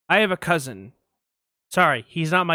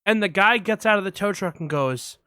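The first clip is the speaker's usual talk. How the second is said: ordinary talk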